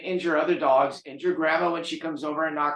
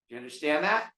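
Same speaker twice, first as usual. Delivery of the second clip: normal talking voice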